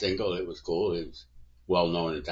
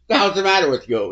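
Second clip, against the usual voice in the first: higher, louder and fast exclamation; loud, rapid what's-wrong-with-you retort; incredulous shout; 9 semitones higher, 9 dB louder, faster than usual. Retold or imitated. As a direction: bark it out in disbelief. Chipper laughing at the cop.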